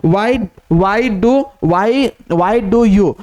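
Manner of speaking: drawn out, word by word; slow dictation. Shown as a demonstration. Teacher dictates the phrase slowly to the class. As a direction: read it out slowly for students to write. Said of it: "teacher dictating a shorthand phrase".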